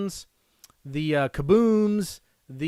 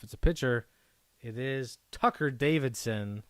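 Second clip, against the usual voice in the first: ordinary delivery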